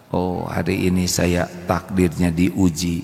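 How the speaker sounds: low, slow accepting sigh; calm acceptance. Acted. Patient wife accepting husband's anger, low slow calm voice.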